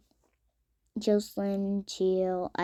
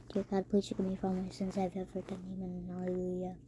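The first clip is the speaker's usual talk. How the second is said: rushed mock prayer; 11 dB quieter, much faster than usual. Mock prayer before falling.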